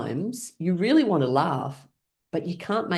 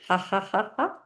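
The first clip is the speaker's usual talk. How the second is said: higher, rhythmic ha-has; demonstrated laugh; 4 semitones higher, 3 dB quieter than usual. Shown as a demonstration. Teaching the class to pour with laughing, deliberate laugh. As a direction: step out deliberate ha-has, pitched up. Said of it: "with laughing".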